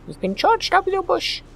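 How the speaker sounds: high, slow cartoon voice; silly high voice. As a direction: squeak like a little bush. Joke continuing silly voice.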